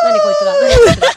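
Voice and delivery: loud, high-pitched playful protest; mock outraged shout. Host reacting to teasing praise, mock outraged shout. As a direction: shout it twice in pretend disbelief.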